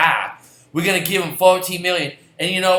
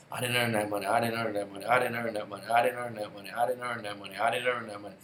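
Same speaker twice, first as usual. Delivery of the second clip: much softer, lower, repeated like a haunted mumble; mock guilty madman; 7 semitones lower, 13 dB quieter, faster than usual. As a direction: chant it under your breath like a man losing it. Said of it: "in the crazy house at some point going".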